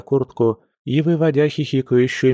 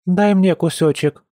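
put-on high, insistent child's demand; a little girl insisting stubbornly; 8 semitones higher, 3 dB louder than usual. A narrator's reading. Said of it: "упрямо твердила Аида".

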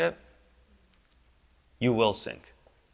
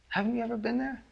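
ordinary talk, nothing marked

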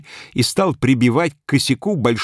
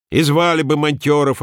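louder, higher retort; a touch of a sharp snapped retort; snapping back; 6 semitones higher, 4 dB louder than usual. A narrator's reading. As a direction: snap back in irritation. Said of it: "огрызнулся Анисьин муж".

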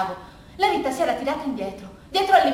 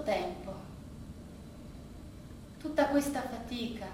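hushed and calm; 6 semitones lower, 12 dB quieter than usual. Acted. Rita speaks calmly before jumping.

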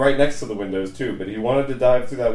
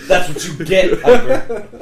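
laughing taunt; 7 semitones higher, 7 dB louder than usual. Acted.

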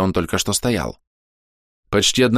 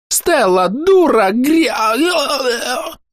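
hysterical scream; 4 dB louder, much slower than usual. A narrator's reading. Ogress dies screaming.

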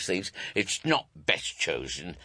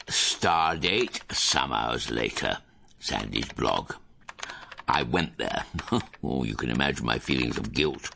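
low, measured confiding narrator; blog narration; 5 semitones lower than usual. Acted. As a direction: narrate like a self-important diary entry.

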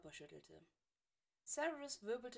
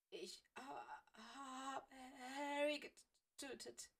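lightly voiced shocked boy; stunned stammer; 5 semitones higher, much slower than usual. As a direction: choke out the confession, barely there. Weasley in shock after accident.

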